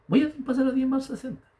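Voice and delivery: high-pitched, held-up declaration; retold insistent resolve. Husband retells insisting on his tithe to his wife, high and resolute. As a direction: insist on SESENTA in a high, sustained voice.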